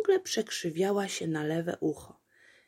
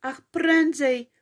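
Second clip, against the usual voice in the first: higher, louder, slow urging; 8 semitones higher, 7 dB louder, slower than usual. A narrator's reading. Apolejka urging the donkey, higher and louder. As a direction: press the donkey on. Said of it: "szeptała Apolejka".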